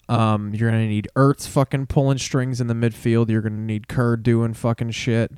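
usual speaking voice

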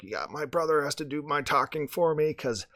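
croaky lost voice, strained; hoarse voice. Retold or imitated. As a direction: rasp it out like a sore throat. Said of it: "the terrible voice".

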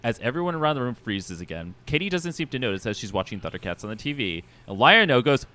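usual speaking voice

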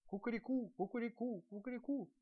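high-pitched repeated cock-a-doodle-doo; roosters crowing. A narrator's reading. Roosters answering each other at dawn, high crowing. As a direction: crow like village roosters calling back and forth. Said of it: "начали перекликаться по всему аулу петухи".

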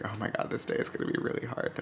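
weary muttered whisper to himself; tired whisper. Retold or imitated. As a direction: sigh it out under your breath. Retold tired thought, unvoiced.

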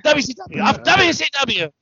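high, hammed-up exclamation; imitated wrestling rant. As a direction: imitate an over-the-top wrestling promo. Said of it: "And Mean Gene goes".

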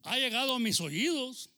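high, loud proclamation; declamación de Pablo. Acted. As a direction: declaim it like an apostle addressing his church. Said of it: "Pablo decía". (proclamado en voz alta y aguda)

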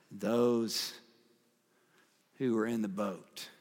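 slow, soft worshipful reading; reverent reading. Acted. Preacher reading reverently to the congregation, soft and slow. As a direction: linger on each word in awe.

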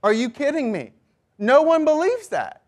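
high, exasperated mock disbelief; incredulous onlookers. Everyone around answering the denial, incredulous. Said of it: "everyone around you is like".

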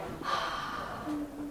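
long breathy sigh out through the mouth; open-mouth exhale. Shown as a demonstration. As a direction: breathe out hard, like checking your breath. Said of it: "I want you to say".

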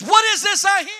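high, loud accusing demand from the prophet; stern demand. Retold or imitated. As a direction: bark the question like an outraged prophet. Samuel confronts Saul in retelling.

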